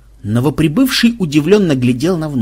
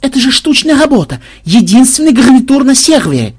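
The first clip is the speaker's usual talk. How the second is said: high, loud wailing protest; tearful shout; 10 semitones higher, 6 dB louder than usual. A narrator's reading. Madam mourning her one-off furniture set, tearful shouting. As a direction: wail it out in high-pitched distress. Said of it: "со слезами в голосе крикнула мадам".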